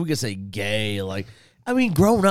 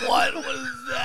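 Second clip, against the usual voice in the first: laughing disbelief; 13 semitones higher than usual. Real, not acted. Laughing at friend's gesture.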